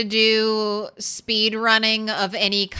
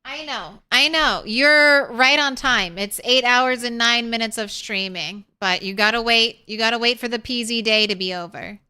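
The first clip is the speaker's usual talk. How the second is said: normal talking voice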